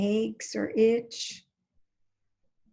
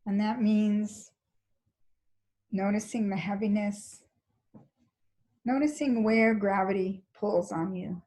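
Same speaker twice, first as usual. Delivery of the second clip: calm, even body-scan guidance with long pauses; guided meditation; 4 dB quieter than usual. Real, not acted. Teacher leading a class into a body scan, calm guided meditation. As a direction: guide them gently, leaving space between phrases.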